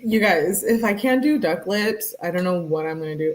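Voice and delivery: normal talking voice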